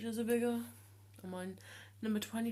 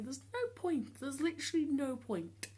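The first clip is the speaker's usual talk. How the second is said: exasperated, voice pitched much higher; fed up; 6 semitones higher than usual. Real, not acted. Patient sick of being misgendered at the doctors, exasperated. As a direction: protest it in high-pitched frustration. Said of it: "I'm sick of it".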